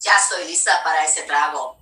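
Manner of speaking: low-pitched Spanish line. Shown as a demonstration. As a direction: drop the voice well down for the line. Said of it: "It's, like, a lot lower".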